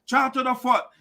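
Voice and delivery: normal talking voice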